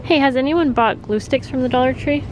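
usual speaking voice

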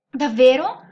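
light up with hopeful delight, rising at the end; happily curious. A narrator's reading. Little elf learns he has powers, delighted. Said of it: "si informò contento".

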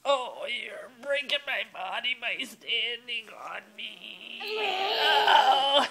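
slow hammed-up whimpering wail; mock crying. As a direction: fake sob under the weight. Child standing on mother.

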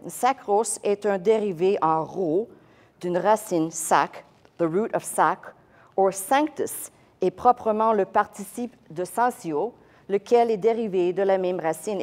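French text read with French vowels and uvular r. Shown as a demonstration. Teacher reading the French scholar to the class. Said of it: "I'm gonna read the French".

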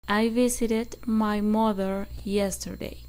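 very slow, each word spaced for repeating; slow model sentence. Shown as a demonstration. Teacher dictating slowly for students to repeat. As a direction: read it slowly so students can echo every word. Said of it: "Repitan después de mí".

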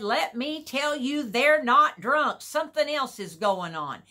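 acting out someone standing up to a crowd; bold, backbone. Peter straightened up to answer the crowd, bold and firm. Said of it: "He had a backbone like he hadn't had before. He straightened up".

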